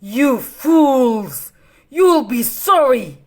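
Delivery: loud furious villain shout; angry cry. Acted. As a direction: hurl it at them as you storm out. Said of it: "Cruella cried".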